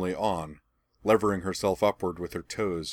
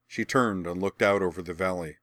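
usual speaking voice

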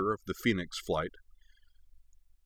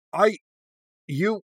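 high, broken-off sputter; 11 semitones higher, 4 dB louder than usual. A narrator's reading. Tenzin lost for words at Korra, sputtering. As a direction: stammer single words with gaps, lost for words. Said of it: "Tenzin sputtered".

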